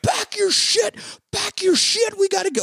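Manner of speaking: high-pitched panicked imitation yell; frantic freak-out. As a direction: burst in urging everyone out, panicked. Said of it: "horribly distraught and freaking out".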